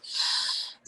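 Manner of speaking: soft, breathy sigh of giving in. Retold or imitated. Caving to the aunt's chocolate brownies, a soft resigned sigh. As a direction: sigh as if the brownies have already won. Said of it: "I'm just like".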